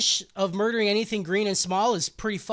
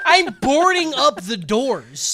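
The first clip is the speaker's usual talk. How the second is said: loud, higher, drawn-out comic vow; mock declaration; 4 semitones higher, 10 dB louder, slower than usual. Friend topping the joke, loud and drawn out. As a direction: declare it big and slow, amused.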